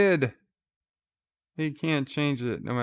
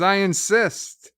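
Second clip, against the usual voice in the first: insisting; 7 semitones higher, 6 dB louder than usual. Retold or imitated. Sisko pressing Weyoun to drink.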